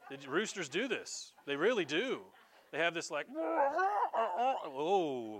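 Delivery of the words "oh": high, loud pubescent crow; cracking young rooster. Retold or imitated. Preacher imitating young roosters before dawn, cracking crow. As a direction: crow like a rooster whose voice is breaking. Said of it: "pubescent voice cracks".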